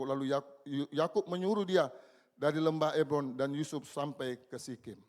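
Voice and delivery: ordinary delivery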